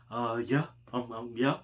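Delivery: low, soft, hesitant mumbling act; playing dumb. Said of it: "Play dumb".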